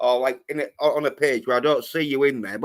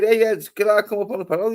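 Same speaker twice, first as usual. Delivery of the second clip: higher, fast imitation of a polite request; mock timid asking; 8 semitones higher, much faster than usual. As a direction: squeak it out like a nervous guest. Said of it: "you'd be saying".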